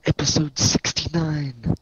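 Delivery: announcer intro. Acted.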